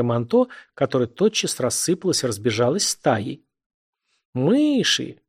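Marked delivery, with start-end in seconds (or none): 4.3-5.2 high, drawn-out mock yell; merry cry. A narrator's reading. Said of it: "повернулась на весёлый крик. Это вопил". (весёлый выкрик повыше)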